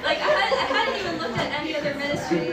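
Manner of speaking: loud, high, laughing through the words; laughing while talking. Real, not acted. Telling friends about joining the ministry, cracking up. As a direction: struggle to talk through real laughter. Cracking up mid-story with the audience.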